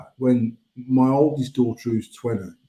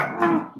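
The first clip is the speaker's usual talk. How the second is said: squeamish yelp; 5 dB quieter than usual. Retold or imitated. Steve's reaction to stretched phlegm.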